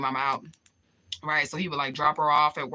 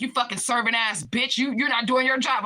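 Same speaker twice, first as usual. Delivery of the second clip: raised, heated mock scolding; retold angry berating, pitched up; retold flipping out; 6 semitones higher, 3 dB louder than usual. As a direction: imitate a man going off on a waitress. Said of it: "he fucking flips out on her".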